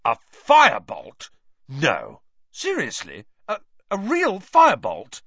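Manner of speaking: loud, high, amazed disbelief. A narrator's reading. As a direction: gasp it out in astonishment. Wood amazed at the news.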